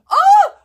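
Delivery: a huge high yelp of dismay; hammed-up shout; loud oh shout. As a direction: cry out at the smell. Reacting to smell discovery.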